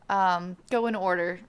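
ordinary delivery, nothing marked

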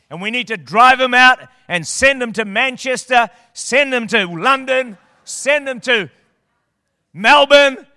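rising preacher's rhythm, swelling to a loud last word; building sermon cadence. Real, not acted. Preacher driving the devil out of town, building. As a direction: hammer the send him to, then land the city.

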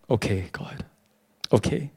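soft, low resigned agreement; acted meek, grudging okay; reluctant surrender. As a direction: give in quietly, voice dropped low. Retells giving in to God.

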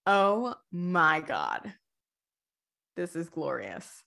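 melting into a lovestruck sigh; dreamy swoon. Retold or imitated. Fan gushing over Thanatos, slow and hushed.

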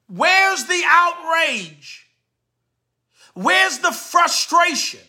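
Where's the outrage? said very slowly, each word dragged; slow, drawn-out. Real, not acted. Slow, drawn-out rhetorical repeat.

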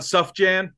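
SUFJAN as "SUFF-jan", short U; joke mispronunciation. Shown as a demonstration. Host teasing about wrong names, "suff-jan" mispronunciation. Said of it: "if somebody says [name]".

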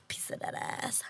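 soft unvoiced whisper. Real, not acted. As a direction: whisper it to yourself. Joking aside while eating.

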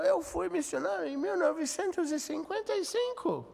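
put-on very high, loud, delighted old man's voice; excited old missionary. Old missionary overhearing Portuguese, very high excited exclamation. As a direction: gush like a thrilled elderly foreigner recognizing Brazilians.

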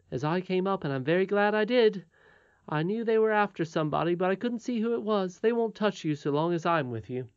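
a hint of a cheerful young miner; confident miner boy. Miner boy reassuring those he met, lightly voiced.

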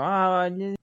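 high pleading voice; pleading friend. Retold or imitated. Voicing the Tamagotchi owner asking.

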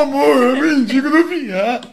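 slow, whimpering imitation of a husband; comic tearful pleading; mock crying. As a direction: plead through hammed-up tears. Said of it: "começou a chorar".

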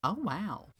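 high, amazed little gasp of wonder; a man gasping at a toy review; mock amazed "oh wow". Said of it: "he was audibly saying".

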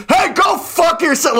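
very high, slow, drawn-out comic outburst; retold angry shout. Friend thinks the honk is for him, mock outraged. Friend curses at honking car.

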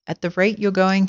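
plain reading voice

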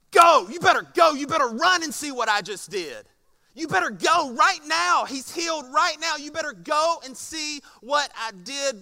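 urgent, forceful shout to go now; aggressive go. Retold or imitated. Jesus sending the royal official off with an aggressive shout. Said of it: "It's much more aggressive than that".

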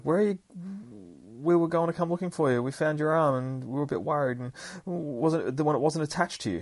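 put-on high, excited kid's babble; excited and a little nervous; nervous excitement. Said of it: "Gabby's all excited, so she's like".

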